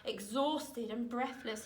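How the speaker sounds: ordinary delivery, nothing marked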